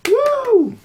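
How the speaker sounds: cheering the newlyweds with a high whoop. Acted. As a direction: whoop for the couple.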